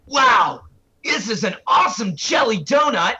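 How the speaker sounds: high, excited cartoon character voice; Brock. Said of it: "Brock talking about a jelly donut".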